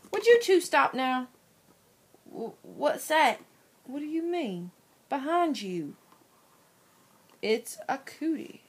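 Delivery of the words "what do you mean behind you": ordinary talk, nothing marked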